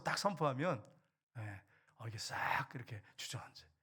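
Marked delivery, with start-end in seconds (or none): 1.4-3.7 soft whispered aside. Real, not acted. Pastor confiding to the congregation in a whisper. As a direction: drop to a breathy secret whisper. Hushed aside after proclamation.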